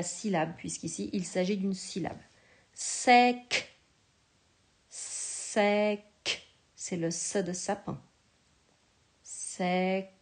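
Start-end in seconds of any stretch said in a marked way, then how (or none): 4.9-6.0 "Sec" said apart, crisp final k; dictated syllable. Shown as a demonstration. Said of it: "tu vas écrire la syllabe sec".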